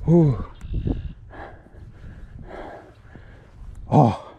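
panting, out of breath; puffing between words, out of breath. Real, not acted. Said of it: "I'm out of breath".

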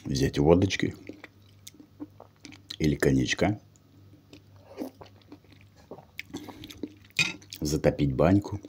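chewing while talking; words muffled by food; mouth full of food. Real, not acted. Seen 0: Spoonful taken at -1.0; cheeks and mouth show chewing while speaking.

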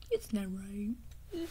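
slow, soft whisper; slow hurt whisper. Acted. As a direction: breathe it, hurt and alone. Hurt aside, unheard.

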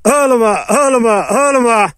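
high crying shout calling his son; high-pitched wailing call. Real, not acted. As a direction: cry out to the dog in a high wail.

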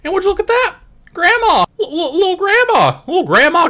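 very high excited voice; squealing with glee over grandma; giddy squeal. Acted.

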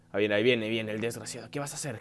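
low, very soft voice; wary, low-pitched undertone; tense hush. Real, not acted. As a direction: keep it down as the threat nears. Watching the enemy approach.

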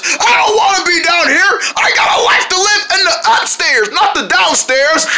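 high-pitched wailing protest; frantic, high complaint; distressed whining. Acted. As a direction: wail that you are trapped, hammed-up. Acted distress at being trapped.

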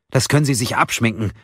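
mildly indignant retort; snapping. A narrator's reading. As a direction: reject it with a touch of temper. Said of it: "fauchte Mia". (leicht fauchend, empört)

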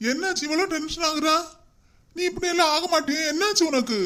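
baffled by his son's mood; worried surprise. Acted.

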